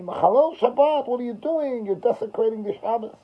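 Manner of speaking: high-pitched mock scolding; retold yelling. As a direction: imitate indignant neighbors yelling, not at full volume. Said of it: "Yell at me".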